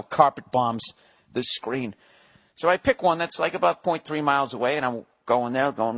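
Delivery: normal talking voice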